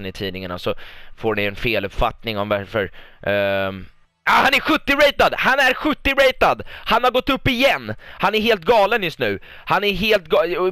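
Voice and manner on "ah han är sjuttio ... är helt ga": much higher, louder squeal of delight; overjoyed outburst. Real, not acted. Manager thrilled as Haaland hits seventy rating, high excited squeal. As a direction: burst out in giddy disbelief.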